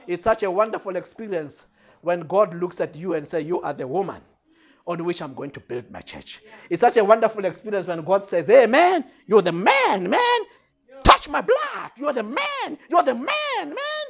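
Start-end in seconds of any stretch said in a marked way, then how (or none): 3.5-6.4 low, soft pronouncement; solemn quote. Acted. God looks at a woman and chooses her, solemn and quiet. 8.5-14.1 high, swooping buddy-style cheer; playful hype. Acted. God hailing his man like a buddy, playfully excited.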